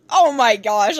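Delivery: normal talking voice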